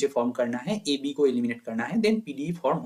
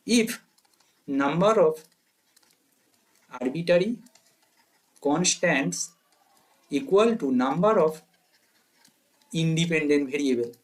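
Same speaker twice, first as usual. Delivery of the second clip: very slow, broken into short phrases; note dictation; much slower than usual. Shown as a demonstration. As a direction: dictate with long gaps for writing. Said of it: "तो अभी note लिखिए".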